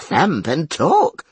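high, slow pained gasp. Acted. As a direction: squeeze it out in agony. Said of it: "as though in a paroxysm of pain".

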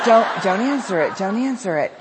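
laughing don't answer it; urgent self-talk. Retold or imitated. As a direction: tell yourself not to pick up. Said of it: "And I'm like".

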